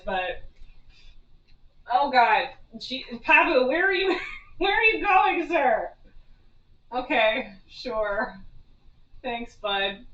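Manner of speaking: usual speaking voice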